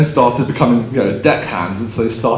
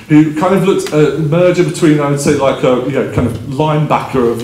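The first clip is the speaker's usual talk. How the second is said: ordinary delivery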